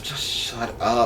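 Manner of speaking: slow, quiet mock exasperation; pure annoyance. Imitating the brother's reaction to the dramatic boy, annoyed. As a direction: drag it out softly, utterly fed up. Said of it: "pure annoyance of".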